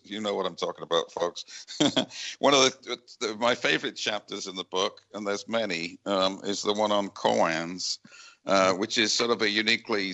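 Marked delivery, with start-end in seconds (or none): none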